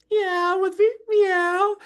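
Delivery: very high squeaky cat meow; a tiny kitten mewing up high; kitty meow. Shown as a demonstration. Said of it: "Okay, everyone say".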